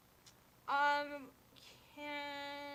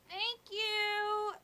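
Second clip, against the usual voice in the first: high cheery thanks; bright sing-song gratitude; chirpy thank you; 10 semitones higher, 7 dB louder than usual. Acted. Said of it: "they're like".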